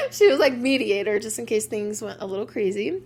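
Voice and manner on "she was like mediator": laughing. Real, not acted. Laughing about sister as peacemaker.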